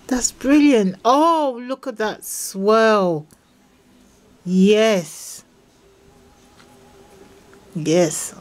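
normal talking voice

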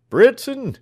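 high, loud call across the yard; an old doctor shouting for his man. Acted. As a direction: bellow for the servant. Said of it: "cried the doctor".

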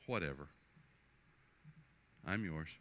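ordinary delivery

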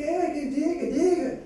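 put-on high-pitched nosy old woman; gossipy old lady. Old lady eagerly asking for gossip, voice pitched high. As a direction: pitch it up like a busybody granny.

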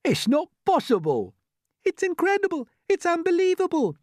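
very high exclamations of disbelief; astonished cries. Acted. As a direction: exclaim in rising amazement. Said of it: "they cried".

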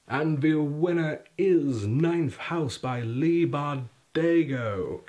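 high, loud and slow announcer voice; dramatic winner reveal. Acted. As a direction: build it like a big reveal.

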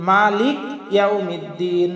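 "মালিক ইয়াউমিদ্দিন" unstretched, cut at K; common misreading. Shown as a demonstration. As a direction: read "মালিক" ending on K, no final I. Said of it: "এটা আমাদের সম্পূর্ণ ভুল".

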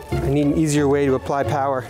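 usual speaking voice

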